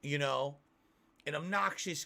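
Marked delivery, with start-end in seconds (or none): none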